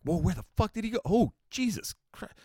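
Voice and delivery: high, shocked yelp of disbelief; startled ghost sighting. Retold or imitated. Tourist spotting the vanished ghost boy, high startled yelp. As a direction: jump out of your skin at the empty spot. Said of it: "And it's like".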